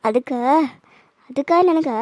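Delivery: ordinary talk, nothing marked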